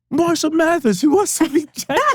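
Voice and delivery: very high, loud English-accented imitation. Label man explaining who [name] is, high English accent. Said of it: "doing his, like, English accent".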